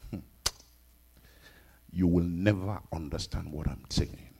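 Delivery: normal talking voice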